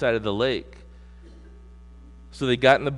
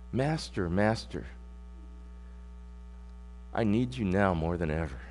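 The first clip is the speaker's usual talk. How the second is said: quiet pleading; 6 semitones lower, 8 dB quieter than usual. Acted. Pleading prayer voiced in sermon.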